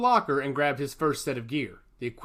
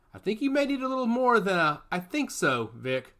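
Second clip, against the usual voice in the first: confident; 6 semitones higher than usual. A narrator's reading. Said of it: "said Justin confidently".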